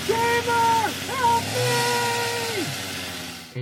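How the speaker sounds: very high scream for help; terrified. Acted. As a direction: cry out in panic, way up high. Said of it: "Aster looks terrified".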